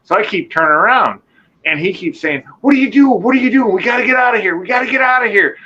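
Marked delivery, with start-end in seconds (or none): 2.6-5.6 frantic panic. Retold or imitated. Cousin urging the speaker to leave the woods, frantic and high. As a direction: beg to get out of there, panicked.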